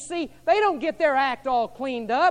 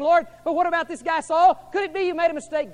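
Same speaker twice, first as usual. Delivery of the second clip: higher, louder fretting complaint; complaining Ananias; 7 semitones higher, 9 dB louder than usual. Retold or imitated. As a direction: whine your doubts to the Lord. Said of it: "complaining and saying".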